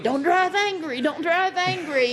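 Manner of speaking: a hint of an actor riffing in character; imitating Bill Murray. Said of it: "And Bill Murray's going".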